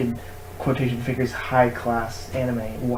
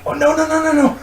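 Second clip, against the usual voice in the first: panicked; 14 semitones higher, 4 dB louder than usual. Pretend. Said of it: "as you get".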